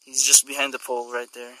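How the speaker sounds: usual speaking voice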